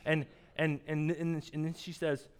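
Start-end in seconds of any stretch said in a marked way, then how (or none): none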